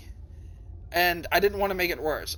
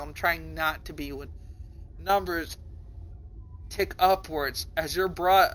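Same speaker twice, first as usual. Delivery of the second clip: ordinary talk, nothing marked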